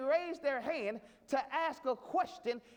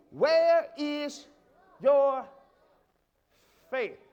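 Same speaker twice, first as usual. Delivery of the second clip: loud, drawn-out words with hard pauses; booming challenge; 8 dB louder, much slower than usual. Real, not acted. Preacher presses the church about their faith, booming challenge. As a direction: drive each word home at the congregation.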